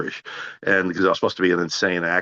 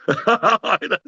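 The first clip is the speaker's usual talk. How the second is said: laugh; 14 semitones higher, 3 dB louder than usual. Real, not acted. Laugh after friend's line.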